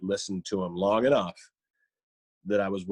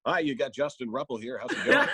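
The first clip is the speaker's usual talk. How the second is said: upbeat radio-interview voice, lifting higher at the end; radio guest greeting, pitched up; 3 semitones higher than usual. Shown as a demonstration. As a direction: put on your on-air phone greeting. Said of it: "I'll do that radio voice".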